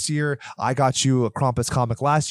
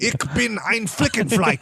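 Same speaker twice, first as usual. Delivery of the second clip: higher, hammed-up German line; mock German; 10 semitones higher than usual. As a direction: spout it like a mock German film actress. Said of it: "and it's like".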